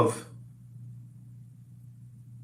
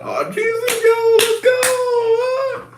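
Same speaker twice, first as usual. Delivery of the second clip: joyful yell. Real, not acted. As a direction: burst out cheering the good news. Cheering the pregnancy news again.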